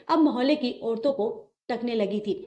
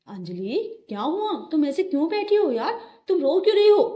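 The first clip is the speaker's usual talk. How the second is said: concerned husband rising to an anxious higher pitch; worried husband; 6 semitones higher than usual. Acted. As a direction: coax her, worry growing. Husband finds wife crying.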